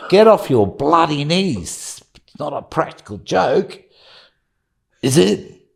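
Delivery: high, drawn-out Australian scolding; mock Australian outburst. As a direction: tell him off in a hammed-up Aussie accent. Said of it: "the mute Australian suddenly goes".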